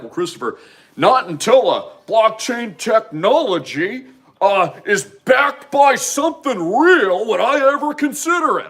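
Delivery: high, slow, snooty doubter voice, rising as it goes; mock skeptic voice. Mocking critics who wanted real backing, high and drawn out. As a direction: parody the know-it-all critic, drawn out.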